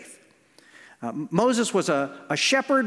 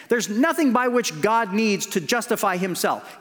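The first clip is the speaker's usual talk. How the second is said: ordinary talk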